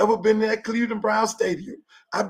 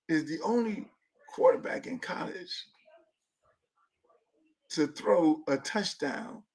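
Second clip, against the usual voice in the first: quieter and slower, almost confiding; hushed quoted stat; 3 semitones lower, 7 dB quieter than usual. Retold or imitated. Host quoting a stat to his viewers, hushed and slow. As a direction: read it out low like a secret fact. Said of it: "They say".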